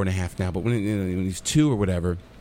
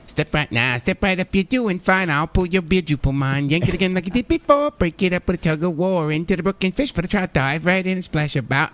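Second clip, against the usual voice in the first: high, loud cartoon square-dance call; Bugs Bunny voice; 7 semitones higher, 4 dB louder than usual. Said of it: "I know the whole thing".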